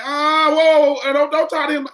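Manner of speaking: loud, high "whoa, whoa" to break it up; stepping in. Retold or imitated. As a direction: loudly step between them and stop it. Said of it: "I'm like".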